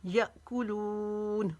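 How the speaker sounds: यअकुलून very slow, कू short and लू long; slow recited यअकुलून; tajweed recitation. Shown as a demonstration. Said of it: "इसको लू को लंबा खींचेंगे".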